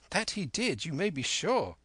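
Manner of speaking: put-on high-pitched young lad confirming; lightly voiced youngster, high and certain; eager boy. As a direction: insist up high like a keen boy.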